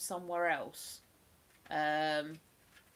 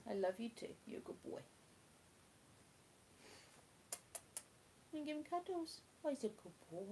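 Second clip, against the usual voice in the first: softer, higher cooing at a sleepy pet; 6 semitones higher, 14 dB quieter than usual. Real, not acted. Owner cooing over her sleepy dog, soft baby talk.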